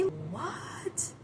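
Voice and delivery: high, hushed "what?" of disbelief; soft, high mock disbelief; mock shocked reaction. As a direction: act out whispery shock, rising at the end. Acting out his shocked reaction.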